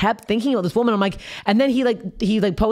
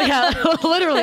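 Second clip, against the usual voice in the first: laughing; 8 semitones higher than usual. Real, not acted. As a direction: laugh through the words, pitched up. Laughing response.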